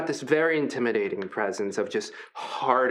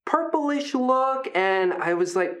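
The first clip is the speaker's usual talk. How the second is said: a lightly higher, friendly voice for a huge gentle creature; friendly hulking Brutus; 3 semitones higher than usual. Acted. Big gentle wrestler Brutus welcoming the new fighters, upbeat. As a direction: play the big guy as sweet and encouraging.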